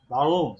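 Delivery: "বাগুম" with the R held back, close to a g; swallowed German R. Shown as a demonstration. Teacher explaining the special German R, swallowed in "বাগুম". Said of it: "আরটাকে উজ্জ রাখে".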